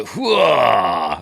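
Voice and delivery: a big hammed-up whoa; imitating the fella's whoa; mock whoa. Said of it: "He does a proper".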